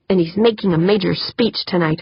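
plain reading voice

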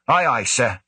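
snappy mock-military reply; mock salute. A narrator's reading. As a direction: play the toy soldier for laughs. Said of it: "a lampoon of a soldier's coming to attention".

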